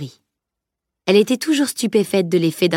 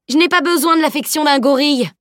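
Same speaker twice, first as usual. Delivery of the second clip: higher, indignant yell; 8 semitones higher, 3 dB louder than usual. A narrator's reading. Mock-indignant shout, pitched up. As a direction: Salim yells at the knight carrying him off. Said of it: "hurla Salim".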